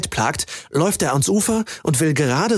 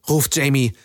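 ordinary delivery

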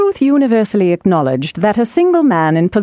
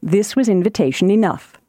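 plain reading voice

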